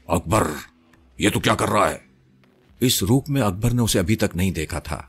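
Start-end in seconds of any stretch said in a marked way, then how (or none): 0.1-2.1 possessed woman speaking in a man's voice; masculine voice. A narrator's reading. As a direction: challenge him in a lightly voiced manly tone. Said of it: "وہ مردانہ آواز میں بولی". (مردانہ آواز میں)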